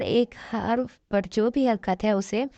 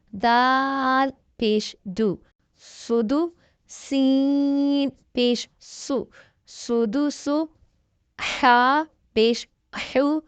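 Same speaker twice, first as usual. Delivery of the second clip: slow, drawn-out syllable spelling; teacher reading the Qaida aloud slowly; spelling drill; 3 semitones higher, much slower than usual. Shown as a demonstration. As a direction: sound out each letter slowly for a child. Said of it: "اس پہ سیٹی کی آواز نکالیں گے".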